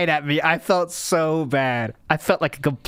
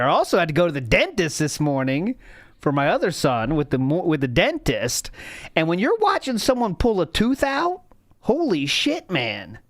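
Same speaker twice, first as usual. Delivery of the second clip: ordinary delivery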